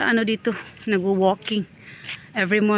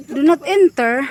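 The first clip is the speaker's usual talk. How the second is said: higher, louder questioning "Do not enter"; baffled, raised-pitch echo; puzzled repeat; 4 semitones higher, 5 dB louder than usual. Real, not acted. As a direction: question the sign in disbelief.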